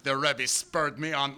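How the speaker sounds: ordinary delivery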